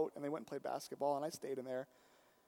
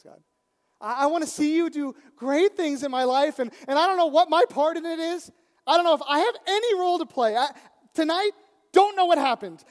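loud, high, pleading and rising; fervent prayer; 8 semitones higher, 13 dB louder than usual. Retold or imitated. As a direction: beg God for more, heart wide open. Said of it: "coming before God and saying".

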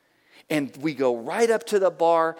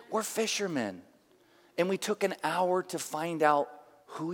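softer, tender sincere answer; gentle reassurance; 4 dB quieter than usual. Retold or imitated. Pastor offering to pray for the couple, gentle. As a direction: answer quietly, as if offering a blessing.

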